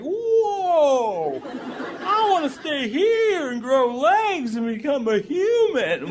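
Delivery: a wide-eyed fish dazzled by land; salmon's wonder. Pretend. A salmon amazed by the air, high and slow. Salmon voiced leaping from water.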